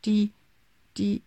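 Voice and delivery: put-on halting, stammering child; stuttering. A narrator's reading. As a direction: stumble over the first word, guilty. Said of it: "stotterte Pauli".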